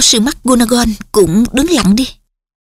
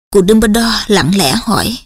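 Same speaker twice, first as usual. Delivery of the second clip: ordinary delivery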